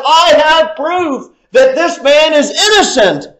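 ordinary delivery

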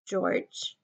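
small, soft, hesitant call; softly voiced, unsure question; timid. A narrator's reading. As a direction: timidly try for his attention. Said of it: "Lenny looked timidly over to him".

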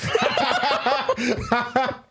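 high, cackling laugh through the words; laughing hard. Real, not acted. Telling the Vegas story, laughing hard. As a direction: crack up mid-swear.

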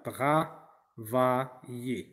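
«Travailler» étiré, syllabe par syllabe; très lent et articulé. Shown as a demonstration. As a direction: fais entendre chaque son de «Travailler». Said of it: "travailler, c'est un verbe de premier groupe". (le professeur articule «Travailler» très lentement)

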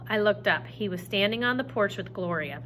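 ordinary delivery

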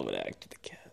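whispered aside; quiet aside about Nala. Real, not acted. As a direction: drop to a whisper. Sudden whisper mid-chat.

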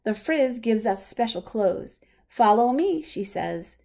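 ordinary delivery, nothing marked

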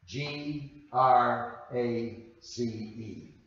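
"G R A C E" slowly, letter by letter; spelled out. Shown as a demonstration. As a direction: spell "G R A C E" with a beat on each letter. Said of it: "let's try grace in that same way".

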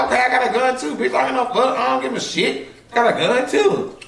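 retelling how she talked tough about a gun; mock tough talk. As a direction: act hard while cracking up. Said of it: "I'm like".

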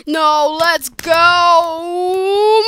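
loud, drawn-out shout while fleeing; panicked yell. Real, not acted. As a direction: holler it as you run away. Fleeing TNT players.

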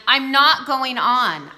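loud, slow, firm refusal; said loudly. A narrator's reading. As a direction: plant your feet and refuse out loud. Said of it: "I said loudly".

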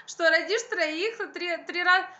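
ordinary delivery

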